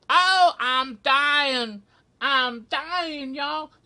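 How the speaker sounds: high, loud, drawn-out Southern wail; hammed-up death throes in a mock Southern accent; dying Smaug. Poor puppet dragon being stabbed, melodramatic high wail. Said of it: "and the poor dragon is".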